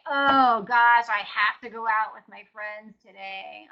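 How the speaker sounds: loud groaning "Oh gosh", burdened "have"; hammed-up dread over a fun plan; mock reluctant. Joke about dreading a night out with friends, mock reluctant. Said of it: "most people don't say".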